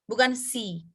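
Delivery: ordinary talk, nothing marked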